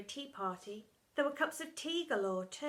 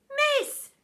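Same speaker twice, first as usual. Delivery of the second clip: very high, loud cry; yelping child; 12 dB louder than usual. Acted. Pupil interrupting Miss Seeth, high yelp. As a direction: cry out to stop her.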